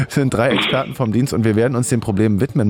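ordinary talk, nothing marked

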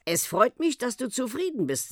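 mildly embarrassed uncle, voice lifted. A narrator's reading. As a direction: say it a little sheepishly. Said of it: "sagte der Onkel verlegen".

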